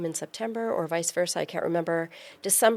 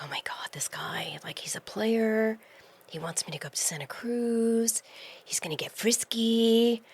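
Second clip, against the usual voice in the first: whispered retelling of wary private thoughts; hiss-free, breath-only whisper, as if confiding a suspicion; conspiratorial whisper; 3 semitones higher than usual. Retold or imitated. Woman recalling her doubts about a flirty traveller, whispering.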